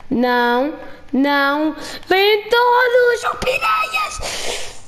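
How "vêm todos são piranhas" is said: piercing high wail of alarm; panicked shriek. Real, not acted. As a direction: shriek as the swarm closes in. Swarm of piranhas attacks the player.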